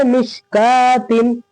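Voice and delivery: मिशकातिन slow, long held "kaa", no "w"; correct silent-waw reading. Shown as a demonstration. Teacher answering what we will read, drawn out. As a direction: stretch मिशकातिन syllable by syllable. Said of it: "क्या पढ़ेंगे?".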